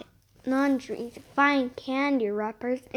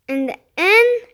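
In the end said higher and louder; louder restart; 6 semitones higher, 6 dB louder than usual. Shown as a demonstration. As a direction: restart In the end with more force.

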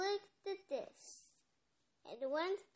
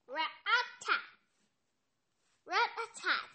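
loud knocking call; 5 semitones higher, 6 dB louder than usual. Acted. Knocking sound effect read out.

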